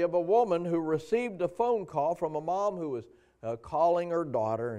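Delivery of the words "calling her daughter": ordinary talk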